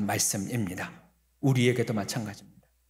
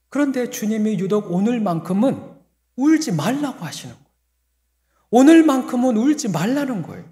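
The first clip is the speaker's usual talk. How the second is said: higher and louder preaching; rising sermon emphasis; 8 semitones higher, 8 dB louder than usual. Real, not acted. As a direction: build the point with force. (점점 힘있게 외치는 설교)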